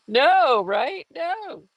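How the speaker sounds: high, playful "no" that starts loud; exaggerated swooping protest; mock protest. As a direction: burst out in pretend objection. Mock protest after laughing.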